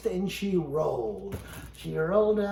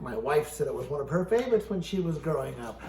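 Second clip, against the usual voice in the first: usual speaking voice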